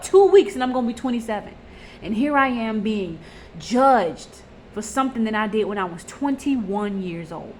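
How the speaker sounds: ordinary delivery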